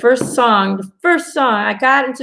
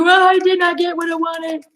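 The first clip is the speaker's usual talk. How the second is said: raised, petulant complaint at the universe; frustrated complaint; 7 semitones higher than usual. Retold or imitated. Psychic retelling her grumble at the universe, mock sulky. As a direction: whine it like you didn't get your way. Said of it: "I'm like".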